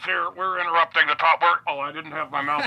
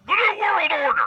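Alex Jones impression; 14 semitones higher than usual. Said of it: "It's Alex Jones".

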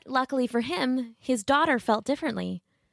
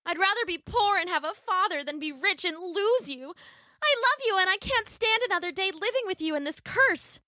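very high, impassioned daughter; Marigold; 10 semitones higher than usual. Acted. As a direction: argue desperately with father.

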